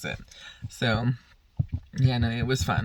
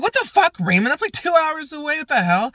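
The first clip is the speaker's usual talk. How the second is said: much higher and louder, shocked disbelief; incredulous outburst; 13 semitones higher, 4 dB louder than usual. Retold or imitated. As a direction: shout it in disbelief at Raymond. Retold outburst at the long drive.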